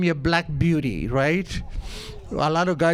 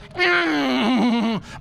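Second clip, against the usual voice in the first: higher whinnying cry; horse noise; 5 semitones higher than usual. Retold or imitated. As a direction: mimic the horse rearing. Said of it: "And the horse goes".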